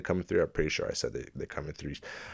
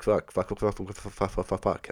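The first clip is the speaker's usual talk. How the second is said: mock constant swearing; 4 semitones higher than usual. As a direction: rattle off the swear word like a machine. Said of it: "Why you swearing so fucking much?".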